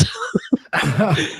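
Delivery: amused laugh at the message just read. Real, not acted. Laughing at the red-pilled message.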